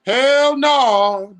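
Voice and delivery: emphatic disgusted shout; loud refusal. Real, not acted. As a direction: yell it, horrified. Reacting to mint chocolate chip.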